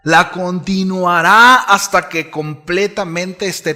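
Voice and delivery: declaiming Paul's words to the congregation, loud and high; fervent scripture reading. Acted. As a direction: preach the promise out to the whole room. El apóstol Pablo le dice a los filipenses.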